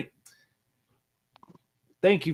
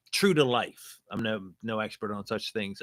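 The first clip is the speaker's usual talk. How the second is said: ordinary talk, nothing marked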